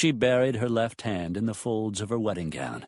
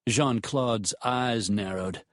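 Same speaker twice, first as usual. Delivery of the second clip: ordinary delivery, nothing marked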